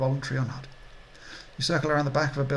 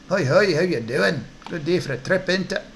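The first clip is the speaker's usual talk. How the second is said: sing-song Scottish hawker, higher and louder; Scottish accent; 4 semitones higher, 5 dB louder than usual. Acted. Twitchy orc dealer greeting customers, Scottish accent. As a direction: greet them in a swooping Scottish voice. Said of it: "Don't know why he's Scottish".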